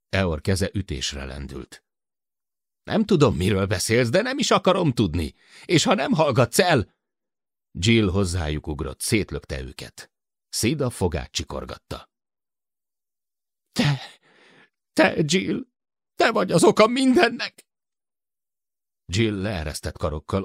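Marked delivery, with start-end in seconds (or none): 2.8-6.9 heated warning, rising high; raised, impatient warning; angry threat. A narrator's reading. Eor threatens Sid, about to strike. 13.7-17.7 very high, strained outcry; enraged high-pitched accusation; furious accusation. A narrator's reading. Sid accuses Jill, grinding teeth.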